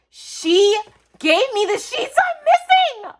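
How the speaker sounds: very high, loud squealing; loud high-pitched ecstatic outburst; overjoyed. Real, not acted. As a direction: squeal it out, overwhelmed with delight. Overjoyed at missing sheets.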